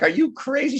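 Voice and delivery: high, incredulous mock outcry; mock disbelief. Department chair shocked at the new play.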